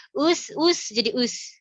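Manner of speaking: "us" with the u losing its rounding; domah hilang. Shown as a demonstration. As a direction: pull into the s too soon, so the u of "us" gets lost. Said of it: "domahnya jadi hilang". ("us" dengan bunyi domah yang hilang)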